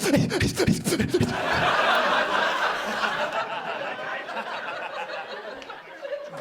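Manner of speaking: breathy airless nerd laugh that forgets to breathe; dork laugh. Shown as a demonstration. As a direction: wheeze it out like a rich dork. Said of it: "What the fuck was that".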